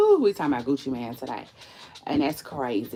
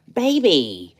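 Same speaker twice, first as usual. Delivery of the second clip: ordinary delivery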